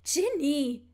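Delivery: loud outraged call of Ginny; indignant cry. A narrator's reading. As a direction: shout her name in shocked protest. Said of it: "rief Hermine empört". (empört gerufen)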